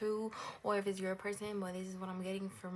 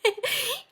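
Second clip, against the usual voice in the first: a sudden high burst of laughter; high bright laugh; 13 dB louder than usual. Real, not acted. Reader laughs at herself before praising pile two, loud giggle.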